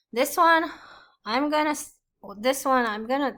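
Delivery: high, loud burst of excitement; excited. Real, not acted. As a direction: jump in excitedly. Excited jump in voice.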